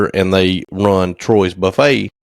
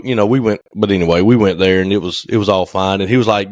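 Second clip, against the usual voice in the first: ordinary delivery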